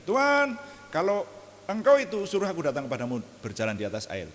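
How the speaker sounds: high, loud eager blurt; impulsive Peter. Retold or imitated. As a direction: rush the request without thinking. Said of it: "Makanya ketika".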